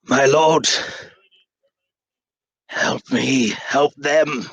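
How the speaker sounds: wounded character calling out loudly; desperate yell. Acted. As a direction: cry out for help across the room. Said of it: "he is yelling out to the room".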